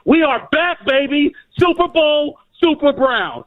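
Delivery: hyped cheer. Real, not acted. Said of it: "I'm emotional".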